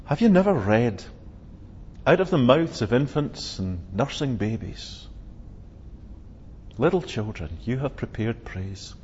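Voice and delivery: low, soft quoting of Psalm eight; a softened, reverent recitation; hushed scripture quote. Retold or imitated. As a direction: let the verse fall quietly. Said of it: "he says to the chief priests".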